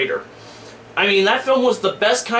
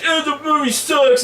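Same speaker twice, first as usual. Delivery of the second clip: dismissive critic; 6 semitones higher than usual. Retold or imitated. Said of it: "when somebody's like".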